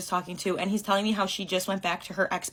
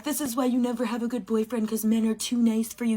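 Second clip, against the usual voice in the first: mock commenter voice; 3 semitones higher than usual. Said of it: "smell the men in my comments".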